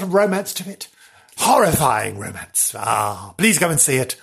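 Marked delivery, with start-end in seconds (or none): none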